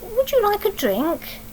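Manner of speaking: a lightly voiced polite mother offering a drink; polite mummy. Sophie's mummy offering the tiger a drink, high and polite. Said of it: "So Sophie's mummy said".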